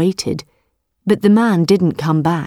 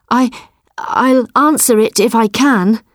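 higher, halting nervous man; 5 semitones higher than usual. A narrator's reading. Frightened farmer answers the tiger, nervous. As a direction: stammer it out, scared of the tiger. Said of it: "so he said nervously".

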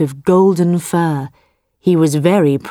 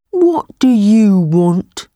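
buffalo voice; 3 semitones higher, slower than usual. Acted. Buffalo answers the tiger.

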